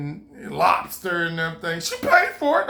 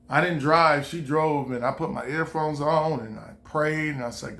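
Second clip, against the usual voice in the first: ordinary talk, nothing marked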